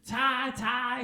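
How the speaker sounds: high, excited name-calling; Chevy. Acted. Chevy greeting Ty, high and eager. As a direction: call the name twice, high. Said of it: "he would say to me".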